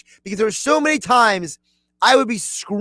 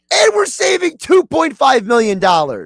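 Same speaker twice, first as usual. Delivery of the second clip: higher-pitched, thrilled outburst; excited exclamation; 6 semitones higher than usual. Retold or imitated. Fan reliving his reaction to the trade savings, excited. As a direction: act out being thrilled, voice jumping up.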